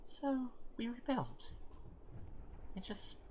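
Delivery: wistful hopeful hero, softening toward the end; hopeful character voice. Acted. Voicing a character.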